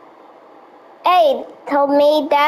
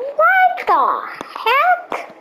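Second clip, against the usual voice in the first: very high-pitched mock astonishment; acted startled outburst; 14 semitones higher, much slower than usual. Character baffled by the stranger's odd talk, high startled voice. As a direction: jump far up in pitch, baffled.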